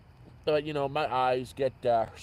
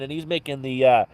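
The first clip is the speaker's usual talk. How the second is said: ordinary talk, nothing marked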